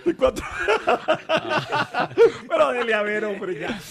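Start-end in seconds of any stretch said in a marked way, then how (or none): 0.5-2.4 high, squealing laughter; real laughter. Real, not acted. Laughing at the banter.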